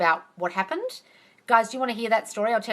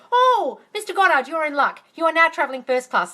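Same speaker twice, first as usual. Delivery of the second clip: much higher, louder chirpy clerk voice; cheery clerk; 8 semitones higher, 7 dB louder than usual. Retold or imitated. Ticket clerk telling Mister Goddard his upgrade, cheery. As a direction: chirp the good news brightly.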